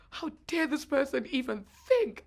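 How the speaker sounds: put-on outraged teen. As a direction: play the offended thirteen-year-old, full of outrage. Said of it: "I was so mad".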